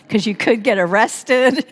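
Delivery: plain reading voice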